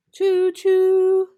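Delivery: high, loud choo choo; loud train call; train whistle. Shown as a demonstration. Repeating train sound.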